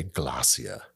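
low British narrator pronunciation; British accent. Acted. Wishing it were in the script, savoured British accent. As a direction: relish the word in a British accent. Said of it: "never once got to say".

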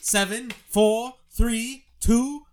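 loud, high-pitched mock grilling; mock interrogation. Co-host grilling the guest in the hot seat, mock interrogation.

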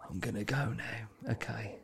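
fading last words, barely there; dying patient. Retold or imitated. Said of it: "It's like".

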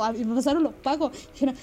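normal talking voice

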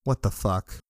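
usual speaking voice

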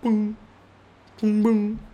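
high, short mock booms; little high-pitched mouth noises; mime sound effects. Streamer playing a mime who can't talk, high booms. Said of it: "now I'm going to be a mime".

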